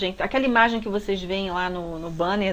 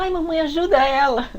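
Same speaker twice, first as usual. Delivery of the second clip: high, loud frightened plea to mom; scared little daughter; 7 semitones higher, 6 dB louder than usual. Retold or imitated. Ten-year-old daughter begging her mother to help, high and frightened. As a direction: beg mom to step in, alarmed. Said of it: "minha filha apavorada".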